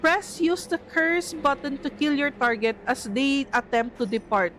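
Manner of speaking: normal talking voice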